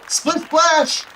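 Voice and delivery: high excited sing-song; playful exclamation. Real, not acted. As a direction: cheer it out playfully. Ball into water.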